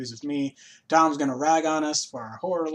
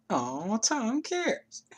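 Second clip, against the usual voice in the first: mock touched; 8 semitones higher, much slower than usual. Slow mock-touched reply.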